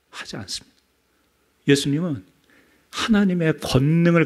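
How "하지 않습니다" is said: hushed whisper; soft unvoiced whisper. Shown as a demonstration. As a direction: drop to a whisper for emphasis. Whispered denial after mock threat.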